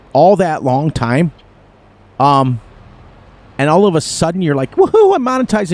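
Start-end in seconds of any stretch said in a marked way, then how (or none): 4.8-5.7 mock cheer, voice jumps up; excited cheer. Creator retelling the day monetization came, mock excited. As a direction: imitate the rush of finally getting monetized. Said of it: "and you're so excited".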